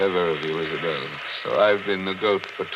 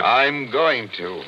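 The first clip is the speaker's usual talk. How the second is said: snapped loud and slow, nerves on edge; irritated loud retort; 6 semitones higher, 8 dB louder, much slower than usual. Acted. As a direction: bark it back, slow and sharp. Tense search, shouts impatiently.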